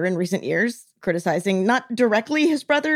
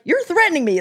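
higher-pitched indignant accusation; retold yelling; 7 semitones higher than usual. Prince accusing the general, mildly mock-indignant. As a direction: imitate an affronted prince, mildly heated. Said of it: "he keeps yelling".

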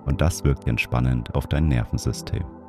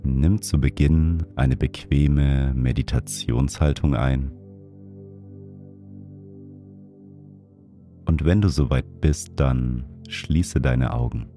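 guided meditation; 3 dB quieter than usual. Acted. Leads a breathing meditation.

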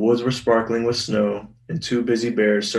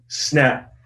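ordinary delivery

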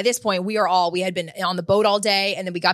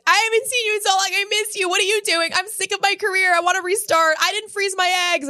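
put-on much higher, fast, gushing girlish voice; drunk friends gushing; 11 semitones higher than usual. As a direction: spill it all out in one tipsy rush.

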